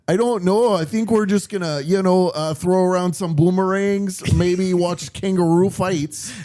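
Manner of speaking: a laid-back Canadian guy shrugging it off; Canadian accent. Acted. Host voices a Canadian living in Australia, goofy accent. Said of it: "But he still has the Canadian accent".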